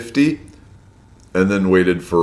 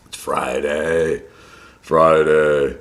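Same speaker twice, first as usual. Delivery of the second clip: rough morning voice; 5 semitones lower, much slower than usual. Real, not acted. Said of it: "Does my voice sound a bit rough?".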